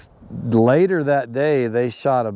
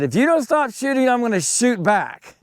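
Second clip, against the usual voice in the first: high, sharp threat to shoot back; retold warning yell; 11 semitones higher than usual. Father threatening the college boys, high angry warning. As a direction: warn them off, voice pitched way up. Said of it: "And so I yelled at these guys".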